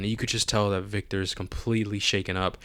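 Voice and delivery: ordinary delivery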